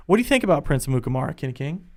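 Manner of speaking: ordinary talk, nothing marked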